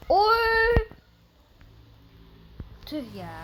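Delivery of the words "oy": sudden high loud yelp. Real, not acted. Player caught off guard in the game, loud yelp. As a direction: blurt out a sharp cry.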